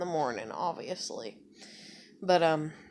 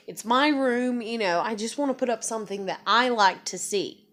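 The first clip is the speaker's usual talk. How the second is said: higher-pitched furious rant, retold; angry outburst; 6 semitones higher, 3 dB louder than usual. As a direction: let it all spill out, fed up and hurt. Retold outburst to dad, crying.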